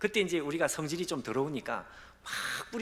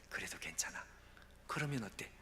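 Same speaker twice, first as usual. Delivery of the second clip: soft, lower, gentle; tender reassurance; 3 semitones lower, 9 dB quieter than usual. Acted. God reassuring the pastor, soft and tender. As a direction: comfort him quietly.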